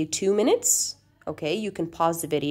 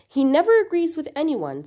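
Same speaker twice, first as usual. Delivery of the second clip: raised, louder quoted line; teacher voicing a character's complaint; acted quote; 8 semitones higher, 5 dB louder than usual. Shown as a demonstration. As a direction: read the quote with lively expression. Teacher acting out quoted speech.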